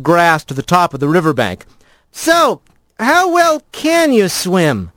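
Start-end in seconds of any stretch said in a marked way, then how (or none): 2.1-4.9 higher, slow call across the river; rat voice calling. A narrator's reading. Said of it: "he called to the lizard".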